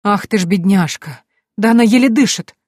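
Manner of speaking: a fussing cook's alarm. A narrator's reading. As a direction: cook sees the frail nanny, alarmed and pitying. Cook sees dying old nanny.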